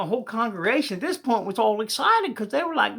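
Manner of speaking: normal talking voice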